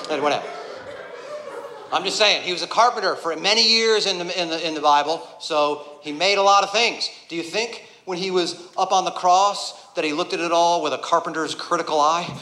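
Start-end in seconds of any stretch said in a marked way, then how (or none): none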